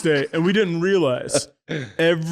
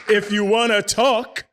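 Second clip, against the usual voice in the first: fiery scolding; 5 semitones higher, 4 dB louder than usual. Retold or imitated. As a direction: scold them, point at the door. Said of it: "Here comes the fire".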